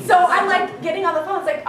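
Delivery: ordinary delivery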